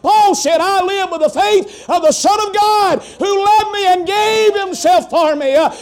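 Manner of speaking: full-force preaching, rising to shouts. Acted. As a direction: preach it out to the congregation.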